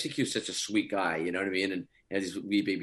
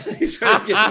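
high, loud, bursting with laughter; laughing; 11 semitones higher, 9 dB louder than usual. Real, not acted. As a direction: laugh your way through the punchline. Laughing at his own scotch punchline.

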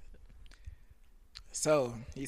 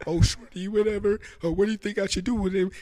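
mock guy on the phone; 5 semitones higher, 6 dB louder than usual. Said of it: "He was like".

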